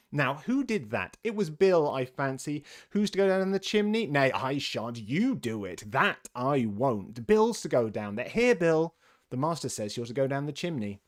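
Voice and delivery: loud, bickering back and forth; bustling argument among helpers; squabbling workmen. A narrator's reading.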